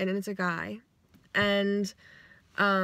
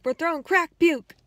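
mock yell; 7 semitones higher, 6 dB louder than usual. Dream stranger yelling an answer.

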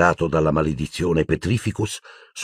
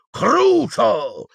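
high, fierce cry; wicked shouted spell; cast curse; 3 dB louder than usual. Acted. Bellatrix casts a curse.

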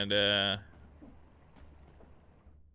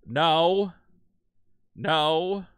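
loud, sharp refusal to a pet; firm scolding; 3 semitones higher, 10 dB louder than usual. Real, not acted. Telling the cat no, loud scolding. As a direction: say it loudly, putting your foot down.

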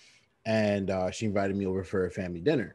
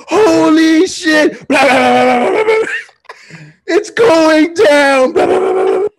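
screaming high and loud, slow drawn out; hammed-up panic yelling through the phone; frantic shouting; 14 dB louder, slower than usual. Friend faking an emergency call, screaming frantically.

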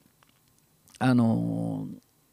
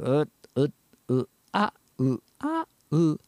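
nasal hums alternating with much higher cries; low to high contrast; 2 semitones higher, slower than usual. Shown as a demonstration. Teacher showing the gap between sheep and cat, low then high. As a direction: swing from low hum up to falsetto. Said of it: "このぐらいの差があるんです".

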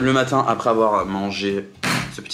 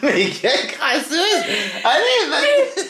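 laughing; 3 dB louder, much slower than usual. Real, not acted. Teasing friend, laughing.

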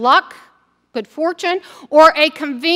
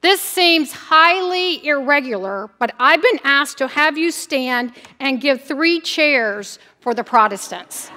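ordinary delivery

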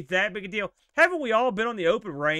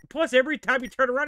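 ordinary talk

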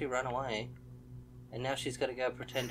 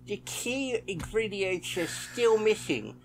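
higher, put-on villain voice; scheming ritual villain; 5 semitones higher, 5 dB louder, slower than usual. Player reads out ritual shopping plans, scheming villain voice. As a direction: read it as a plotting occultist. Reads game character's message aloud.

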